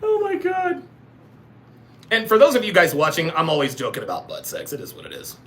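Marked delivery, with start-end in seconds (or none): 0.0-0.8 very high, squeaky voice; laughing squeal. Real, not acted. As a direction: squeak it out after a laughing fit. Breathless from laughing at joke.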